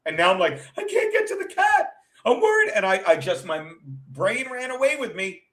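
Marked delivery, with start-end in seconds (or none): 0.8-2.7 high, whiny mock panic, far above the usual voice; panicky self-quote. Retelling how she panicked over the cat at the airport, high and whiny. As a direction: squeak it out like a helpless worrier.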